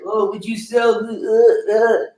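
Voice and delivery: mocking impression. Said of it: "Get outta here with your".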